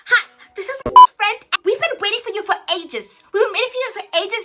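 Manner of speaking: loud, high, laughing friend on the phone; excited friend. Retold or imitated. Friend grabbing the phone, loud and laughing. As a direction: gush down the line like a hyped friend.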